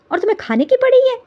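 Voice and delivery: high, fast shout; exasperated outburst. Acted. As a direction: blurt it out, fed up. Outburst at caterers.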